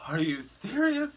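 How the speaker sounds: high, slow mock outrage; exasperated. Lady who can't believe this just happened, imitated as exasperated. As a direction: mimic her utter disbelief, drawing it out. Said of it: "she was exasperated".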